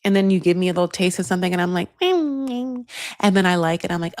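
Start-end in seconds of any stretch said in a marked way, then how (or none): 2.0-2.9 higher, acted mm; tasting hum. Woman given a little taste by Edward, savouring.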